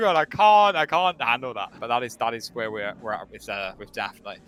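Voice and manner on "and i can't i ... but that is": ordinary talk, nothing marked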